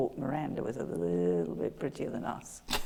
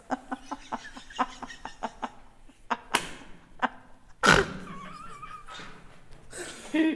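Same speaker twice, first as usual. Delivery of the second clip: high, breathless wheezing laugh; helpless silent giggling fit; hysterical laughter. Real, not acted. Long fit of laughter at friend.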